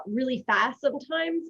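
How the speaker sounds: ordinary talk, nothing marked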